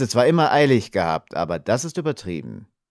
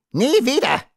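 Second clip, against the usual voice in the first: much higher, louder shrill dwarf shriek; 5 dB louder than usual. A narrator's reading. The dwarf shrieks back at Red Fox, much higher, loud. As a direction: screech it back in horror like a tiny dwarf. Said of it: "kreischte der Zwerg".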